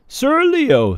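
high, loud, grand announcing; formal little girl. Acted. Jane introducing Leo to her court, grandly. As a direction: introduce him like a herald.

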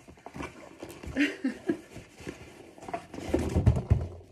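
breathy amused laugh. Real, not acted. After unpronounceable ingredients.

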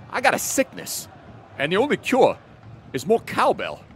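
deadpan with odd pauses; Christopher Walken. Retold or imitated. Actor's famous line about needing cowbell. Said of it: "Christopher Walken?".

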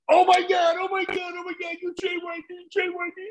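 very high, shouting as he recognises him, fading off; a dealer suddenly starstruck by a wrestler; starstruck fan. Retold or imitated.

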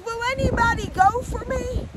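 high, tearful plea with lifted line endings; tearful pleading God. Acted. God sending someone to tell his children of love, tearful pleading. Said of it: "And he's crying".

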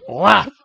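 loud, high "whap"; sudden shouted smack noise; slap sound effect. Retold or imitated. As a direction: punch it out like a slap landing. Imitates the slap.

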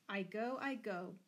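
plain reading voice

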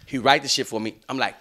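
ordinary delivery

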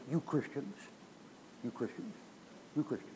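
quiet, repeated grumble; hushed mock accusation. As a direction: quote the accusers under your breath. Quotes accusers of Christians.

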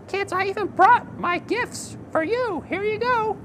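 high excited puppet voice; squeaky eager kid puppet, bouncing with excitement; Nachman puppet voice. Acted. Puppet character speaks.

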